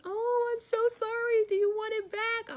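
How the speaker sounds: high, loud, gushing apology; apologetic friend. Retold or imitated. A viewer who won her Fitbit offers it back, high gushing apology. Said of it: "She messaged me, was like".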